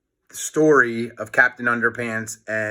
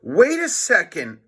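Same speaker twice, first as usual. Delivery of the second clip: raised, higher voice; George crying out; 7 semitones higher, 3 dB louder than usual. Acted. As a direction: burst out in protest. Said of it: "cried George".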